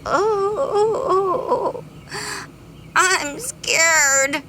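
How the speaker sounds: high, whimpering bee voice; a bee lost in the dark, mildly fearful; scared bee. Acted. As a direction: sound small and frightened. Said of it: "cried the greedy bee".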